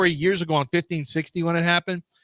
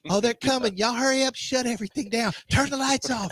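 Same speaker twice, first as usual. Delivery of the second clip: high, hammed-up household alarm; rally everyone to hide, pitched high; mock urgent warning; 6 semitones higher than usual. Someone warns the family to hide, mock urgent warning. Acts household hiding from visitors.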